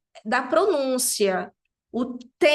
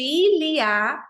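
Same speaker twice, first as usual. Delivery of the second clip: "il y a" said louder and higher, each sound clear; slow clear demo; 4 semitones higher, 4 dB louder than usual. Shown as a demonstration. Teacher modelling "il y a" for students, higher and louder. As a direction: spell out "il y a" slowly for the class. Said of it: "significa tem".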